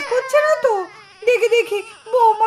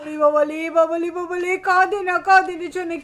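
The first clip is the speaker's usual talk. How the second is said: put-on low cooing baby talk; baby-talk soothing; 4 semitones lower than usual. Grandmother to the wailing baby, cooing baby talk. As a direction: coo in little repeated babbles, pitched low.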